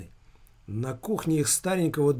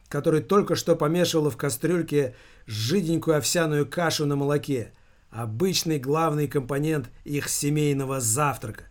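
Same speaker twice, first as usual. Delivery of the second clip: usual speaking voice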